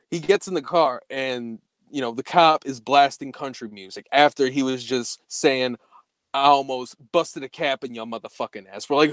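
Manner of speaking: ordinary delivery, nothing marked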